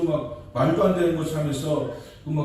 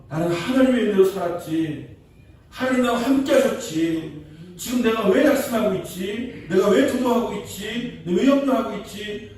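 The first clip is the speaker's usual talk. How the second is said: higher, louder self-questioning, stress on 왜; David rallying himself; 7 semitones higher, 4 dB louder than usual. Acted. David questioning himself after Jonathan's words, rising fervent voice. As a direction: shake off his own fear, gaining courage.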